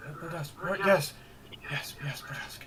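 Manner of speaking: lightly voiced rattled villager; halting, hushed and hesitant; shaken survivor. Survivor NPC recounting the stranger.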